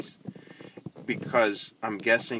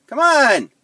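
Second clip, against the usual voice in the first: loud, high exasperated yell; frustrated shout; 15 dB louder than usual. Real, not acted. App rejects his pronunciation.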